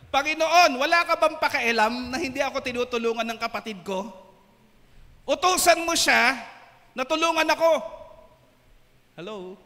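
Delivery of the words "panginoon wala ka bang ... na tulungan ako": tense complaining shout. Acted. Martha complaining to Jesus, loud and tense. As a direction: demand help, voice pitched up in annoyance. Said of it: "Sa Tagalog".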